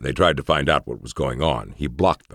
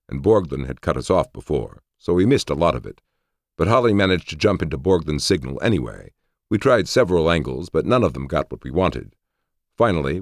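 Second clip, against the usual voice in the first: plain reading voice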